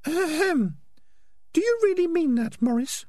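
very high falsetto, sceptical; squeaky female rat voice; prim Peaches. Acted. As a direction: clear the throat pointedly and question him. Peaches challenges Morris, very high voice.

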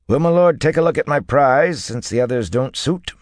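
high, coaxing salesman voice; horse dealer. A narrator's reading. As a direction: coax a lord to view your prize. Dealer offers his prize horse.

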